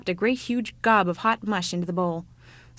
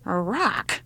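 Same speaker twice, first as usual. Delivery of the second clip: lightly voiced grumpy old woman; 4 dB louder than usual.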